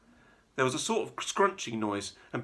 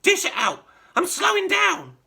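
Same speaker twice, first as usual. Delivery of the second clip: put-on high, loud screechy old woman; greedy old grandma yelling down; yelling crone; 8 dB louder than usual. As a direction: demand it in a shriek. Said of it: "she yelled".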